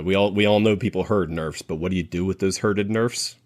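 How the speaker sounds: ordinary talk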